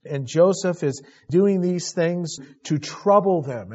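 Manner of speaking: ordinary talk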